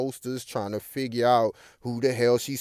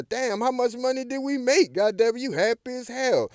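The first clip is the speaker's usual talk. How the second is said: Judy's voice; 11 semitones higher than usual. Retold or imitated. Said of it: "Judy was like".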